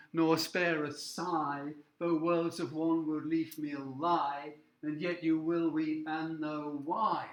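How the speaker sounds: sigh, lie, why drawn out slowly; slow reading that hammers every I rhyme; stressing the rhymes. Shown as a demonstration. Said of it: "three lines with the same I sound".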